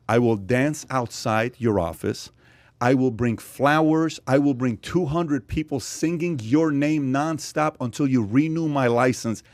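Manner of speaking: slow, measured ultimatum; deliberate ultimatum. Retold or imitated. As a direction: spell out each promise slowly, word by word. Retold ultimatum to the licence office.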